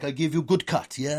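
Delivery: put-on Turkish barber's accent. Barber promising a customer a good cut, mock Turkish accent. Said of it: "I shouldn't do an accent".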